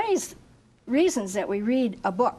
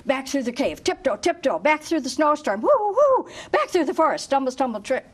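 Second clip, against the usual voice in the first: higher, louder rushed escape; urgent hurry; 5 semitones higher, 4 dB louder than usual. Acted. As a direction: rush back in a panic. Fleeing the bear in a hurry.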